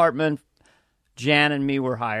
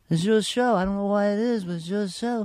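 higher put-on manager voice; Brad Graves impression; 5 semitones higher than usual. Manager to panicked comic in the dressing room, higher put-on voice. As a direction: imitate a manager talking up his client. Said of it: "who kinda talks like this".

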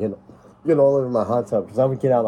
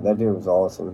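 usual speaking voice

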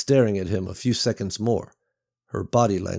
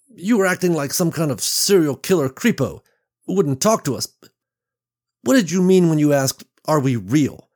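louder, higher, fast rant; agitated accusation; 5 semitones higher, 4 dB louder than usual. Acted. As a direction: unload your fear on him. Beverly confronts kidnapper angrily.

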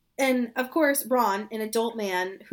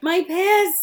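mock wail; 6 semitones higher, 7 dB louder than usual. Joe on his knees wailing.

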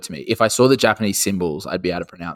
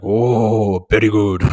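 mock Japanese accent; 6 dB louder, slower than usual. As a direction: overdo a caricatured Japanese accent.

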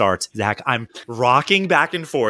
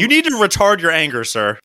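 a hint of a stiff official telling him off; mock official; 2 semitones higher than usual. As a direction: lecture him like a pompous clerk. Mocking official voice to friend.

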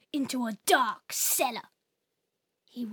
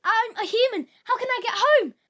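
pleading; 7 semitones higher than usual. Acted. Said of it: "Oh, um".

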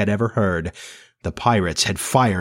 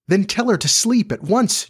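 high-pitched, insistent command; almost ordering; 11 semitones higher than usual. A narrator's reading. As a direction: order it in a much higher voice. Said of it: "John almost ordered".

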